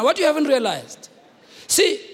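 ordinary talk

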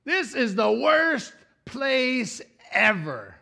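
high, mocking whine of a grumbler; whiny complaining. Israelites grumbling in the wilderness, mock whine. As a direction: whine it like a fed-up kid. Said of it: "and they're like".

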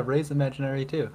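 normal talking voice

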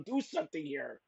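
normal talking voice

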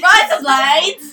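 loud, slow Australian "rise up lights"; Australian accent. Pretend. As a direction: stretch out "Rise" in a mock Aussie accent. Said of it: "That's my Australian for the day".

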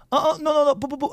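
very Chinese refusal. Shown as a demonstration. Teacher modelling turning down water, very Chinese high-pitched protest. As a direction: fend it off with high, quick repeated syllables. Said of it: "make it very Chinese".

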